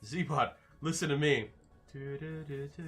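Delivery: ordinary talk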